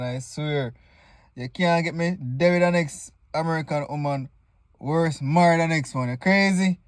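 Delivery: ordinary delivery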